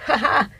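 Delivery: short high mock laugh of the plotters; mock sneering laugh. Mocking how the powers that be laugh, high sneering laugh. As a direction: imitate the conspirators' gloating laugh. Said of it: "That's how they talk".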